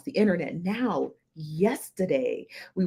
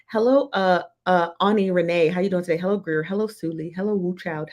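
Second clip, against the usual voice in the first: ordinary delivery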